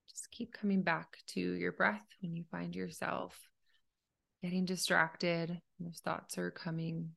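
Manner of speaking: calm, unhurried meditation leader; guided meditation. Acted. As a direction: gently guide a listener through breathing, leaving space between phrases.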